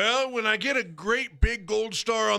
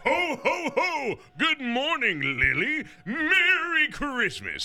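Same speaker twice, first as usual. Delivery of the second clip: high, slow jolly Santa greeting; 6 semitones higher, slower than usual. Acted. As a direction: warm booming Santa hello.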